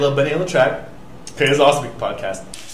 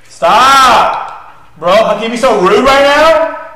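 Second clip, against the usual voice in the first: loud, higher yell of mock outrage; mock outraged yell; 8 semitones higher, 9 dB louder, much slower than usual. Real, not acted. Yelling at her co-host in mock offence. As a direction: shout at her playfully offended. Yelling at friend mid-banter.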